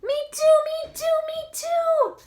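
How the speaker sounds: high, loud little chick; a chick copying the duck, high and loud. Acted. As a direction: squeak it out eagerly like a tiny chick. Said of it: "what the chick says".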